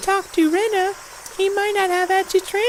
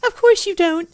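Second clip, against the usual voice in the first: ordinary talk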